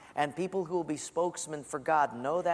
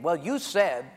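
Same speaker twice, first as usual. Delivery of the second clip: confrontational statement; 5 dB louder than usual. Retold or imitated. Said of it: "give a statement".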